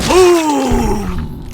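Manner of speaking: long, loud, high boom falling away; drawn-out triumphant yell; shouted gun blast. Pretend. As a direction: holler it like an explosion. Mimicking his shotgun blast in game.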